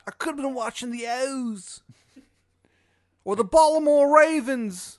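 high, slow wail in a bad Maryland accent; Shithead Steve, bad Maryland accent. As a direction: draw out the grievance in loud, broad Maryland accent. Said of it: "His bad Maryland accent".

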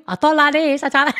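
high-pitched imitation Chinese accent; mock Chinese accent. Shown as a demonstration. Showing off accents for host, mock Chinese accent. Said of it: "I can do Chinese for you".

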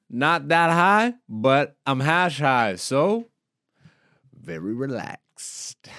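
ordinary talk

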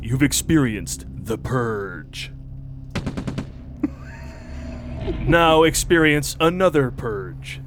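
slow, dramatic movie-trailer narration; ominous trailer announcer; horror trailer voice. Acted.

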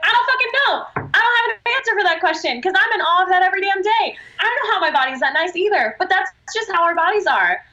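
high, emphatic incredulous outburst; exasperated awe. Retold or imitated. To her crying client, a high amazed outburst. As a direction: blurt it out, amazed and half-laughing.

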